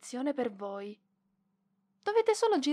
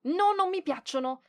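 higher and louder, embarrassed protest; flustered refusal; 7 semitones higher, 6 dB louder than usual. Acted. Librarian brushing off the boy's compliment, flustered.

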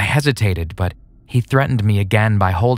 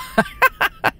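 evil cackle. Acted. Villain after threat.

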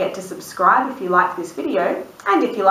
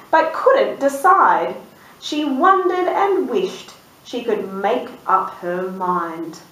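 slow suspenseful storybook build; reading to children; 2 semitones higher, slower than usual. A narrator's reading. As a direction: draw it out to the reveal.

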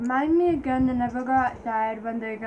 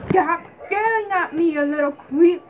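mock snapping; 6 semitones higher, 3 dB louder than usual. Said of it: "just like".

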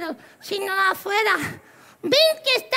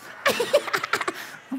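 airy staccato giggle; clownish punchline laugh; 3 semitones higher than usual. Pretend. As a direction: cackle at your own gag. Clown laughs after his punchline.

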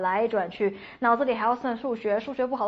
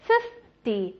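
"fifty" as strong "FIF", then light "dee"; stress on FIF; 3 semitones lower than usual. Shown as a demonstration. Teacher drilling tens, stress on the first syllable. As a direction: slowly, punch the start of "fifty". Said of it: "重音都是哒哒".

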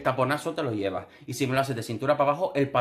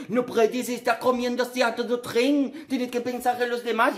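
preachy whine; 10 semitones higher than usual. Retold or imitated. Mocking Tom scolding the hungry teammates. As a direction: scold like a goody-goody. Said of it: "estaba el otro".

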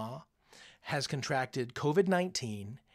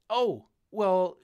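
ordinary talk, nothing marked

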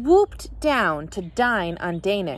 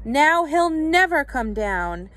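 higher, slow, mild lament; 6 semitones higher, slower than usual. A narrator's reading. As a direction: lament it, drawing out the words. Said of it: "Mister Sims lamented".

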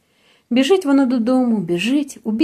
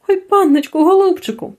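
high, louder begging cry; tearful pleading; 8 semitones higher, 4 dB louder than usual. A narrator's reading. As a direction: plead with the master through tears. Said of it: "благає його Горпина плачуще". (благально, крізь сльози)

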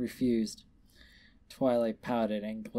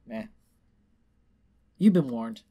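usual speaking voice

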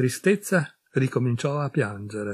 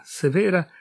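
ordinary delivery, nothing marked

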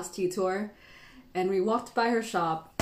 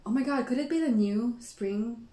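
ordinary talk, nothing marked

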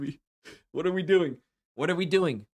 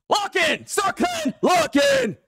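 excited yell; much slower than usual. Real, not acted. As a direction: holler it like a hype streamer. Yelling at chat to focus.

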